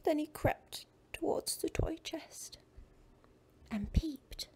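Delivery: suspenseful whisper. A narrator's reading. Suspense in story.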